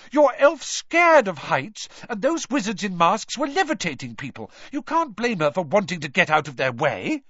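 high, loud indignation easing slightly; angry outburst. A narrator's reading. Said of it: "Hermione burst out angrily".

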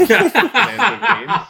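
drawn-out laughing word. Real, not acted. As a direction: laugh through the silly title. Laughing silly title.

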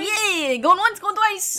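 high, loud auctioneer call; slow auctioneer going once twice. Pretend. Playing auctioneer.

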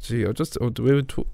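normal talking voice

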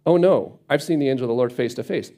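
ordinary talk

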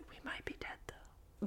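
whispered afterthought; barely audible, voiceless whisper; hushed aside. Real, not acted. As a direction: slip it in under your breath.

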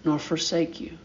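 low, soft and tender, a promise whispered close; God's gentle promise. Acted. Preacher voicing God's promise to his people, soft and tender. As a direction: speak as God, quietly reassuring. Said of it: "And he says".

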